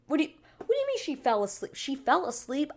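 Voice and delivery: high, loud disbelief; incredulous. Real, not acted. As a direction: blurt it out in disbelief. Disbelieving reaction to the twist.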